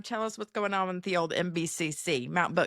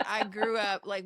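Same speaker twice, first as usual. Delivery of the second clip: ordinary delivery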